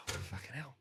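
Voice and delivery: low, soft resigned mutter; quiet mock dread. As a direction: sigh it out under your breath. Dreading the exam.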